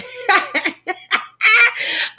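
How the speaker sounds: real high laugh through emotion. Real, not acted. Speaker laughing after apologizing to viewers for crying, high laugh.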